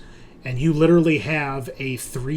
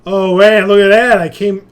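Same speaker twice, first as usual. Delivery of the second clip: much louder, higher sudden delight; excited outburst; 7 semitones higher, 17 dB louder than usual. Real, not acted. Game master to players when his camera works again, loud excited relief. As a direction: burst out thrilled the glitch is gone.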